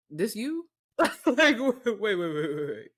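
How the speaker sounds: laughing, high and loud; laughing disbelief. Real, not acted. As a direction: crack up in disbelief. Laughing at old [name] clips.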